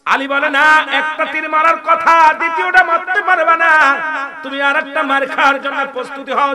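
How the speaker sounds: high, loud fiery sermon voice; preaching at full force. Acted. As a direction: preach it out with fire. Preacher in full force addressing crowd.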